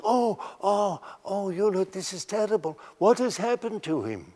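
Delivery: a hint of a fretting old Dutch lady; old Dutch lady's cry. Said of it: "this voice in Dutch saying".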